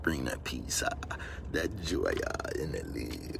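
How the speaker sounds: low, soft and slow, in a put-on voice; mystic voice. On his quest for peace, deep and hushed. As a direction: drop low and intone like a guru.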